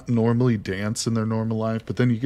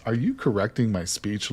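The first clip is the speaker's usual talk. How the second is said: normal talking voice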